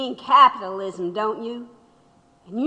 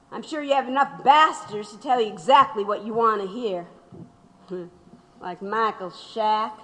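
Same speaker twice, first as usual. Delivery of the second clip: ordinary talk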